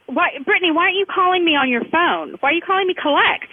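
ordinary talk, nothing marked

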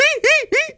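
put-on high, squeaky old-man cackle; grandpa cackle. Grandpa laughing at his own joke, high squeaky cackle. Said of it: "laugh in that very".

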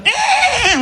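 high-pitched mock neigh; horse noise. Comic telling a horse's arrival, high mock neigh. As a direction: imitate a rearing horse in a high squeal. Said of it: "he does one of the".

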